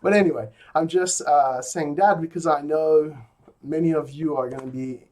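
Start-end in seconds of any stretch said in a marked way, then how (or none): none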